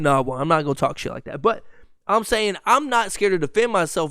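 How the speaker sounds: usual speaking voice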